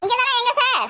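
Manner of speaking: high-pitched calling into the phone; loud phone voice. Acted. Asking sir where he is on poor signal, loud phone voice. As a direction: pitch it up as if the line is bad. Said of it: "அதான் கத்தி கத்தி பேசுறேன்".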